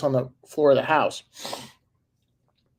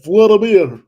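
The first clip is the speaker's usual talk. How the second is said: Trudeau's hammed-up Ukrainian accent, higher and much louder; loud, high mocking Ukrainian accent on the name; 7 semitones higher, 12 dB louder, slower than usual. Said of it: "talking in Ukrainian accent".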